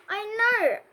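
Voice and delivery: a touch of high alarm; cried out. A narrator's reading. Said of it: "A J cried".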